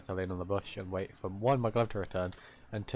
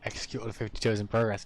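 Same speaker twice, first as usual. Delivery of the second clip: quick run through "Execute Order fifty-two"; brisk status report; 3 dB louder, faster than usual. Pretend. Player reporting the order under way, brisk. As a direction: rattle off the update briskly.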